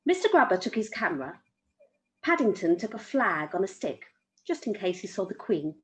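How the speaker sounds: ordinary delivery, nothing marked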